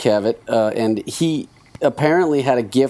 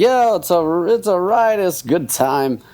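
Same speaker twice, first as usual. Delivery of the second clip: slow, higher-pitched crooner promo patter; 5 semitones higher, slower than usual. Retold or imitated. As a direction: draw it out like a showbiz crooner. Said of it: "And [name]'s like".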